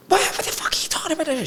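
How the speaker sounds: very high-pitched panicked squawk; high mock outrage; mock freak-out. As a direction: imitate a mum freaking out, voice shooting way up. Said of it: "She's just freaking out".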